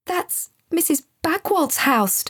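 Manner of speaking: high-pitched startled exclamation; high, astonished gasp; alarmed gasp. A narrator's reading. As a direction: gasp it out as a child who just spotted something. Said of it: "gasped Joseph out loud".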